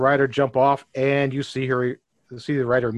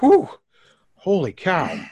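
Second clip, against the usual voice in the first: mildly disgusted; 6 semitones higher, 5 dB louder, slower than usual. Acted. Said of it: "crinkle her nose like".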